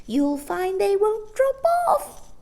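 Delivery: high, slow, pleased shrill voice; eager old woman. A narrator's reading. Old shopkeeper eagerly reassuring the children. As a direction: promise with relish. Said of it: "pleasant expectation".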